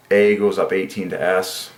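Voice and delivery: ordinary talk, nothing marked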